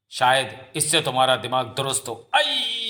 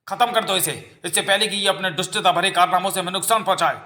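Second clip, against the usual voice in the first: higher-pitched, urgent mob order; villagers' angry demand; 6 semitones higher than usual. Acted. Villagers turning on an accused girl, angry demand.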